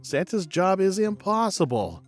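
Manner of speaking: usual speaking voice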